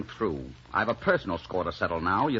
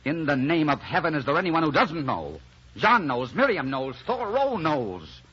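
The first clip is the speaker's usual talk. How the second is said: raised, indignant rant; exasperated outburst; 5 semitones higher than usual. Acted.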